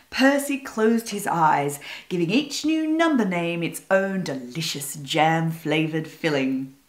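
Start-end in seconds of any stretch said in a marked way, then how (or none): none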